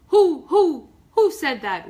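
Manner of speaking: high loud owl hoot. Acted. Wise Old Owl calling from the branch, hooting.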